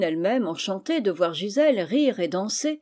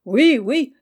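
loud, high shout of yes; the young people answering the aunt with a loud cry; eager cry; 5 semitones higher, 8 dB louder than usual. A narrator's reading. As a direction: shout it back all together. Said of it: "s'écrièrent-ils".

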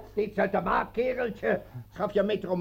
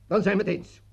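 usual speaking voice

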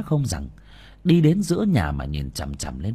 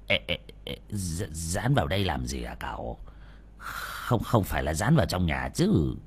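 anxious hushed protest; 5 semitones lower, 6 dB quieter than usual. A narrator's reading. Old man anxiously questions talisman.

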